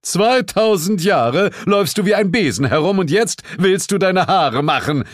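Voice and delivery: loud, high-pitched tirade; furious bellow. A narrator's reading. Husband bellowing at his wife over her hair, furious. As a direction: roar it, red with rage. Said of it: "brüllte er und lief feuerrot an".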